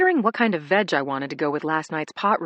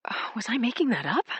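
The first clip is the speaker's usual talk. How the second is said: whispering; 5 semitones higher, faster than usual. A narrator's reading. Dazed inner doubt.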